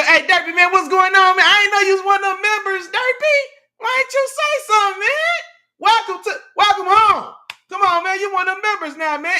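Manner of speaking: loud, high hype greeting to a new member; hyping up the new member, loud and high; excited welcome. Real, not acted. Streamer loudly welcoming Derpy as a new member, excited.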